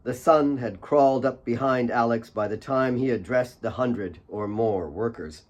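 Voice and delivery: ordinary delivery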